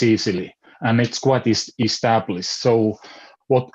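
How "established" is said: normal talking voice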